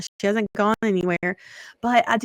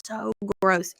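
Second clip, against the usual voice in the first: slow, softer, disdainful; mock disgust; 4 dB quieter, slower than usual. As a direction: drag it out like you find cats repulsive. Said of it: "I was like".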